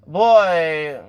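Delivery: high, loud, drawn-out exasperation; fed up, scolding him. Real, not acted. Scolding Henry on screen, in an exasperated high voice.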